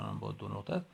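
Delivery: ordinary talk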